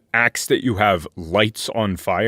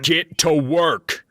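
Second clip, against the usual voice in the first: higher, drawn-out command in a put-on voice; raider giving orders; 7 semitones higher, slower than usual. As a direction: order them slowly, as the raider. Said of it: "one man is going to walk out".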